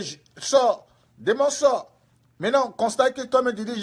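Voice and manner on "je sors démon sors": loud, raised mock command; commanding exorcist. Preacher mimicking evangelist chasing a demon, loud command. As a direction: order the demon out forcefully. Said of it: "toi, tu disais".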